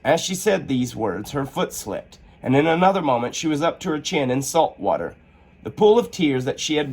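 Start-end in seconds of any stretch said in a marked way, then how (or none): none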